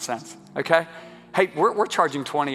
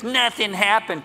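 higher, drawn-out jeering devil; mocking devil voice; 6 semitones higher, slower than usual. Preacher mimics the devil to congregation, high drawn-out taunt. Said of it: "the devil goes".

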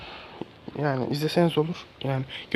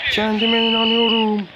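squeaky high voice; 12 semitones higher, slower than usual. Acted. Boasting he will win.

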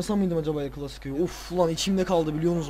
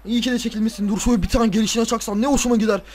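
ordinary delivery

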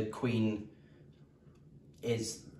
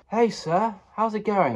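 much higher, louder chirpy greeting; cheery stranger; 10 semitones higher, 6 dB louder than usual. Pretend. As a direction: greet him like an eager stranger in the street. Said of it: "Someone's like".

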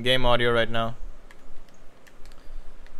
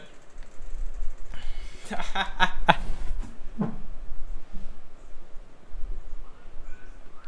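breathy laugh; 6 semitones higher than usual. Real, not acted. Long laugh after thanks.

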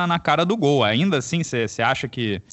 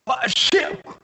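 sneezing; 10 semitones higher, much slower than usual. Real, not acted. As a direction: sneeze out the word Pacheco. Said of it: "Rapidinho que eu vou espirrar aqui".

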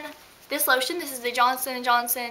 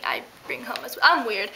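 normal talking voice

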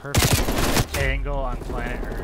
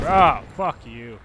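angry; 8 dB louder, much slower than usual. Real, not acted. Raging at enemy in game.

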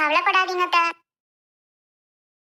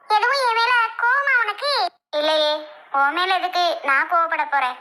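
ordinary delivery

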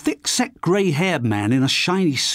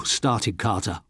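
ordinary delivery

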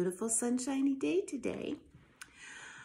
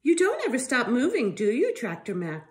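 clucking hen; 9 dB louder than usual. Acted. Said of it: "clucked Carla the chicken".

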